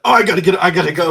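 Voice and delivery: loud, high rushed panic; panicked blurt. Acted. Character rushing off in a panic, loud and high.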